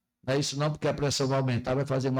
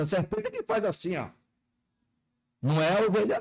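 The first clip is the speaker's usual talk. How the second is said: ordinary talk, nothing marked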